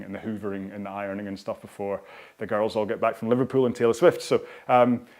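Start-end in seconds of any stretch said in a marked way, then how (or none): none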